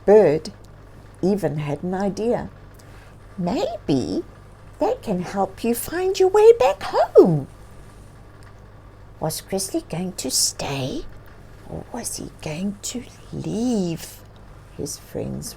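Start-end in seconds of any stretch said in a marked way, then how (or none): 3.4-7.5 a tiny bird comforting the bear; gentle bird voice. Acted. 9.2-14.2 softer whispered question to the listeners; suspenseful whisper. Acted.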